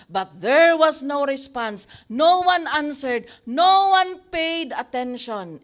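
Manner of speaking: NO hit hard and loud; preacher stressing the silence of Baal, emphatic. Acted. Emphatic sermon refrain.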